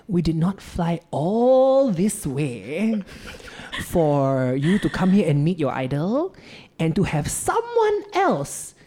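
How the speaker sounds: slow, drawn-out scolding mother; stern mom. Retold or imitated. Mom scolding her son for skipping the selfie, stern. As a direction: stretch the key words like a telling-off. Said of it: "she just gave me this stern look".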